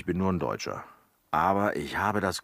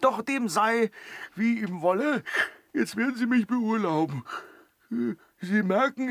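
mock-grand speech pitched high; affected lofty servant tone; pompous high voice; 13 semitones higher, 4 dB louder than usual. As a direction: put on airs, voice lifted high.

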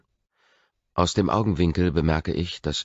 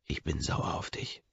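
geflüstertes Geständnis; 6 dB quieter than usual. A narrator's reading. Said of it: "gestehe ich".

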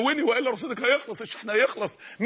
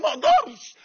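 high, loud, breaking cry; anguished cry; 11 semitones higher, 6 dB louder than usual. Real, not acted. Preacher crying out he cannot bear hellfire, anguished high cry. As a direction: let the voice crack at the top. Peak of the emotional plea.

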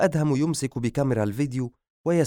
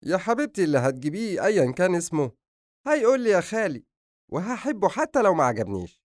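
high, lively uncle-to-be voice; fond joking relative; 8 semitones higher than usual. Acted. Uncle-to-be promising to love the baby, high and lively. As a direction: voice a fond brother, pitched up.

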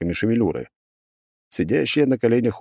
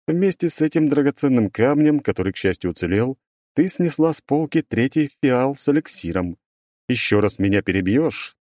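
stern stone monotone; 6 semitones higher than usual. Acted. Golem scolding.

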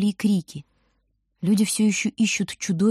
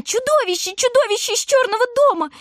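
much higher, louder terrified shriek; 3 dB louder than usual. A narrator's reading. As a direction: shriek it at the top of her voice. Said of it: "визжит она".

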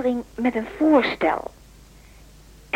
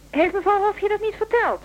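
mock surprise; 10 semitones higher than usual. Feigned surprise on the phone.

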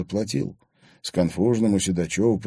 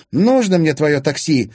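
snorted, louder and higher; scornful snort; 9 semitones higher, 8 dB louder than usual. A narrator's reading. Milana rejects Anton's taxi money with a scornful snort. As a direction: scoff at his offer. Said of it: "фыркнула она".

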